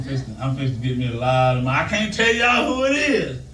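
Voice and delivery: normal talking voice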